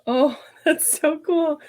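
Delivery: delighted laugh. Real, not acted. Sees finished leggings, laughs.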